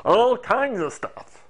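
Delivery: slow, high, pushed silly voice; funny high voice. Shown as a demonstration. Teacher showing varied voices, funny high voice. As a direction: leap way up and stretch it out. Cycling through voices on one phrase.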